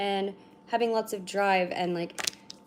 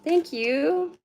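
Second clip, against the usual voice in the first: pet talk; 7 semitones higher than usual. Real, not acted. Talking to cat.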